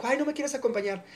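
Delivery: high, sweet coaxing ask; coaxing invitation. Retold or imitated. Friend coaxing the speaker to walk along, sweet and high. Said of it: "me dijo".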